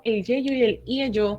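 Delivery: yeyo and ieyo said slowly, each syllable clear; slow pronunciation demo. Shown as a demonstration. Teacher modelling "yeyo" and "ieyo" slowly for students. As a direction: spell out "yeyo" and "ieyo" carefully for learners. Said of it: "Ya les he explicado".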